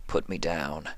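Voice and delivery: quiet toneless request; Sympathy, quiet. A narrator's reading. Sympathy voiced.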